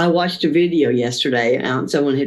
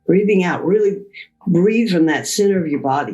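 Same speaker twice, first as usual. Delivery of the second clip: guided breathing. Acted. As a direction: calmly guide each breath in and out. Leads a breathing exercise.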